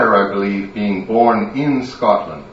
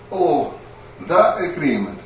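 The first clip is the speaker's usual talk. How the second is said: meek, a little softer, caught out and owning up; sheepish realisation; 5 semitones higher, 5 dB quieter than usual. Acted. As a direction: admit it with a guilty wince. Alec remembers sheepishly.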